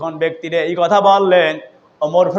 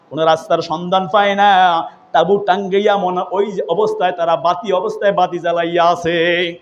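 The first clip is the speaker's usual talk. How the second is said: ordinary talk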